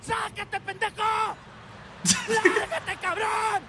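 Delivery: furious Mexican driver cursing in traffic; angry shout. Acted. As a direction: scream at the driver who cut you off. Road rage at driver.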